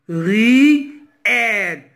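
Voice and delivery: "Ri, elle" as two separate held syllables; split into syllables. Shown as a demonstration. Teacher cutting the word ruelle in two, split into syllables. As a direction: sound out "Ri", pause, then "elle". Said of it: "le premier syllabe".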